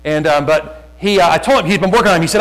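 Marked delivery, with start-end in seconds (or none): none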